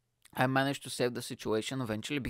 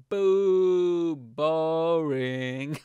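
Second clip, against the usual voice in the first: loud singsong jeer, long drawn vowels; bored heckle; 4 semitones higher, 6 dB louder, much slower than usual. Retold or imitated. Drunk girl heckling the comedian, loud bored jeer. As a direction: drag out each word as a heckler. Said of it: "like, so bored".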